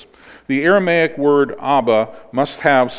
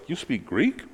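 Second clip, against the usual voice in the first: mildly surprised rising question; higher, softer, understated surprise; 3 semitones higher, 6 dB quieter than usual. Acted. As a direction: ask it with a touch of disbelief. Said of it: "that surprised the commander".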